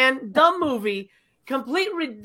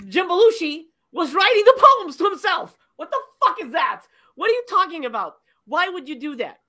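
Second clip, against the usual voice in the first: exasperated disbelief; 8 semitones higher, 4 dB louder than usual. Real, not acted. Ranting disbelief about the twist.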